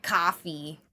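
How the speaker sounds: low New Jersey "cawfee"; rough stab at a New Jersey accent; imitated New Jersey accent. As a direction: attempt a New Jersey COFFEE. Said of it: "She's got that New Jersey accent".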